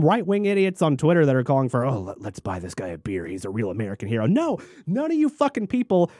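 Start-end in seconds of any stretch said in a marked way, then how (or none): 1.8-4.3 low, soft dopey mock voice. Mocking right-wing Twitter fans in a low dopey voice. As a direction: sneer it out like a dumb fan.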